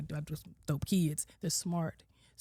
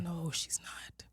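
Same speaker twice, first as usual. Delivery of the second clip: slow whispered aside; 7 dB quieter, slower than usual. Retold or imitated. Doubting her daughter's "I'm good", a slow whisper to herself. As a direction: whisper it slowly, just for yourself. Private thought about daughter.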